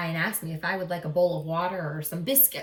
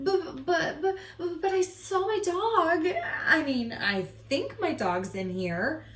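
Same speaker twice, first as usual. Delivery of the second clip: nervous stammer; 8 semitones higher than usual. Acted. Boy stammering at the door.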